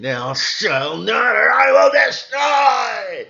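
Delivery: high, loud, slow booming shout; hammed-up thundering god voice; screaming angry god. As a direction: roar it like a wrathful deity. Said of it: "goes around screaming".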